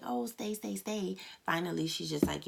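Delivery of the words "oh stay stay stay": mock pleading. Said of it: "instead of crying and being like".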